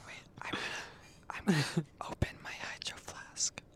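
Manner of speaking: fast hushed ASMR whisper. Acted. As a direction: whisper it into the mic.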